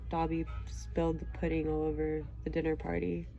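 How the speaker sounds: usual speaking voice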